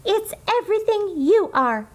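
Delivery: high loving reassurance; tender mother. Acted. Mother reassuring her daughter, high and tender. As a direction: tell her how precious she is.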